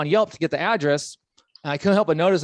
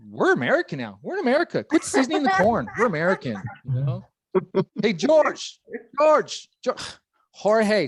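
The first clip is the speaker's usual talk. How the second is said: imitating great grandma; 5 semitones higher than usual. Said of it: "great great grandma woke up and was like".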